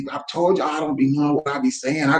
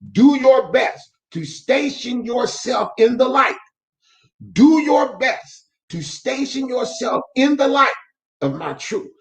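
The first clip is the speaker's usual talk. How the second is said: slow, higher, preacherly reading of the verse; devotional reading; 8 semitones higher, slower than usual. Acted. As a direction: lift the line up like a preacher reading scripture. Reads devotional verse aloud.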